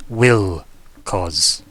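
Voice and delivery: pointed correction with weight on "Will"; stress on Will. Acted. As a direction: correct them by leaning on "Will". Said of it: "corrects me".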